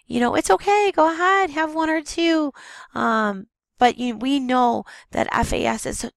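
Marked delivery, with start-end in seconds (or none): 0.3-2.5 high, breezy mock-doctor voice; mock reassurance in a raised, lilting voice; permissive doctor. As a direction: imitate a doctor airily waving it through, pitched well up. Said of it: "there's still doctors out there that say".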